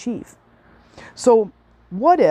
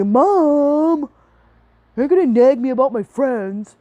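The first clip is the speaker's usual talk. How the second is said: higher, louder put-on teenage whine; whiny teen kid; 6 semitones higher, 3 dB louder, slower than usual. Kid groaning at mom asking about friends, mock whiny teen voice. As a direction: play the eye-rolling kid complaining to mom. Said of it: "And they'll be".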